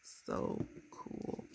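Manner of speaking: soft, breath-only whisper; hushed, whispered gushing; awed whisper. Real, not acted. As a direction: whisper it in delight. Repeats her delight in a whisper.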